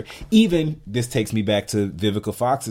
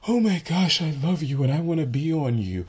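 mock gushing; 6 semitones higher than usual. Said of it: "We're not like".

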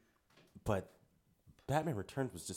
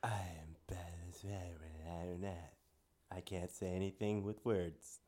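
low, soft whispered purr; sultry Catwoman voice; 5 semitones lower, 10 dB quieter than usual. Retold or imitated. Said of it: "the deep, sultry Catwoman voice".

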